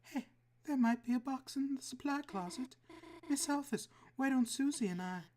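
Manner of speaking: put-on shy sweet girl. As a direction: kind and a little flustered. Noelle voice again.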